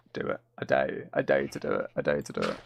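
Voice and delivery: deep, menacing taunt as the cow; mock animal voice. Streamer voicing a staring cow's taunt, deep and menacing. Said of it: "the way it was looking at me".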